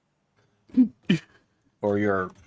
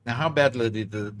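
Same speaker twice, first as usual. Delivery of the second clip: normal talking voice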